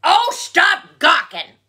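loud, high, drawn-out angry bark; barking badger. A narrator's reading. Angry badger to nosy bear, barking. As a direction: bark it crossly, word by word. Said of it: "barked the badger".

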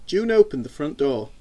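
ordinary delivery, nothing marked

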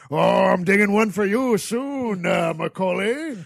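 put-on menacing old caretaker warning; slow ominous raspy drawl. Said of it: "a creepy groundskeeper".

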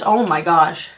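ordinary talk

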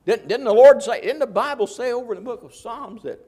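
loud, high rising preacher's question; fervent pulpit challenge; preaching call. Real, not acted. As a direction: fire it out at the pews. Preacher calling out to congregation.

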